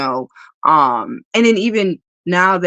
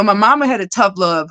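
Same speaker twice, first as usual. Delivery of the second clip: usual speaking voice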